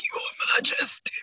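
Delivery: hushed, breathy whisper of a courtier. Retold or imitated. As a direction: whisper it like a deep curtsy to royalty. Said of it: "she came in and was like".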